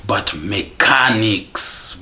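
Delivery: "mechanics" stretched out slowly; slow, weighty stress on "mechanics"; drawn-out emphasis. Real, not acted. Emphatic repeat in a rant.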